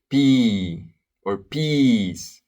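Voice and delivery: teacher shows students the vowel slowly; stretched-out vowel. Shown as a demonstration. Long, slow vowel demo. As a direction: draw out the long E in peas slowly. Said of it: "Think about P".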